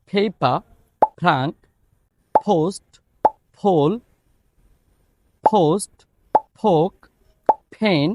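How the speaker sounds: each P released with a strong puff, as in ফ্যান and পোস্ট; aspirated P words. Shown as a demonstration. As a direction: hit every P with a burst of air, word by word. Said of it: "এটার উদাহরণ কিছু word যদি বলি".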